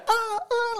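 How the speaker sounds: imitating a panicked flincher; mock timid flinch. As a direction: squeak like someone shrinking from a bird. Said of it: "if you go".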